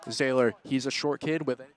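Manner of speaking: rising, loud, fast-building call of the attack; excited play-by-play. Real, not acted.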